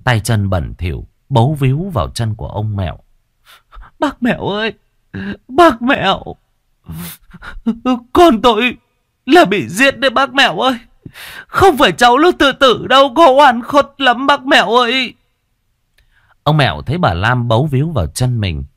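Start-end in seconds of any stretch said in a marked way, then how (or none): 4.0-15.1 high, loud, sobbing plea; tearful lamenting. Acted. Mother begs the chief to believe her daughter was killed, wailing. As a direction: beg through wailing sobs. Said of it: "vẫn cứ bấu chặt lấy ông Mẹo mà than khóc".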